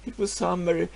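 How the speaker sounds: ordinary talk, nothing marked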